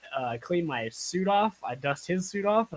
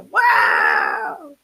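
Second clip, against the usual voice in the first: shout; 11 semitones lower, 8 dB louder than usual. Real, not acted. Shouted cheer at the bar.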